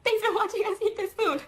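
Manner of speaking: high, chirpy quoted thank-you; lightly acted cheerful sign-off, pitched high; bright retold thanks. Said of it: "I said".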